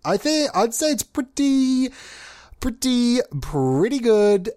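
slow, drawn-out pretty pretty good; lightly voiced Larry David hesitation; Larry David impression. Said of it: "Larry David of me".

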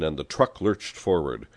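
ordinary delivery, nothing marked

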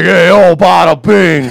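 loud, high mock New York accent; hammed-up New York wiseguy catchphrase; exaggerated New York accent. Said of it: "you definitely got New York".

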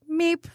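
short, high little car-horn beep; polite horn meep. Shown as a demonstration. Driver imitating a gentle horn tap, polite meep. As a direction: give a small courteous honk. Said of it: "polite, like".

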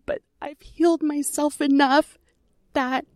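speaking through a real lump in the throat; choked up. Real, not acted. Sharing a painful memory of her mother, choked up. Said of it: "I'm getting a little choked up on that".

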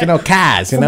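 ordinary delivery

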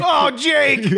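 high yell of own name; ecstatic yell. Acted. Man yells his own name out while coming. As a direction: cry it out in mock ecstasy. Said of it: "I just yell my own name out".

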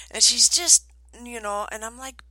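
ordinary talk, nothing marked

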